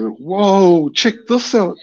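high, wondering whoa; amazed. Retold or imitated. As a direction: marvel at the lights. Amazed at lights near face.

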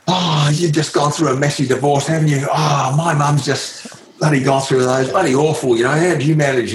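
pry cheerfully like a too-familiar youngster; young Lance Armstrong. Retold or imitated. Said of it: "He said".

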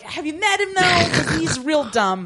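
high-pitched, disbelieving teasing; mock incredulity. Podcaster mocking Gregory as hopeless, incredulous and high. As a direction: start high and incredulous, easing down into a joke. Mocks a character in imagined reply.

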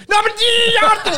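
loud falsetto squeal, breaking into laughter; hammed-up, very high and loud; Robert Hansen. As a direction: squeal it out as a mock impression. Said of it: "så begynder Robert".

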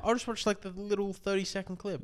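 high mocking voice. Mocking a shorts viewer.

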